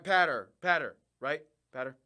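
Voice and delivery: "Patter" repeated with a flat a, like "ladder"; checking "Patter" sounds right; practising the name. Shown as a demonstration. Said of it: "it's like ladder".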